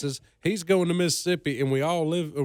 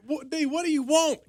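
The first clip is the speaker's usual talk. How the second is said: startled; 11 semitones higher than usual. Retold or imitated. Driver startled by yelling.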